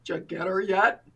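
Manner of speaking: low-pitched imitation of a dry husband; husband's voice. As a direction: drop down low to mimic your husband. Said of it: "And then he said".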